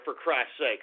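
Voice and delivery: normal talking voice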